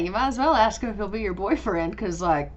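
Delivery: usual speaking voice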